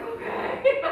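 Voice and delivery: high loud laughing okay; mock resigned okay. Acted shrug of resignation.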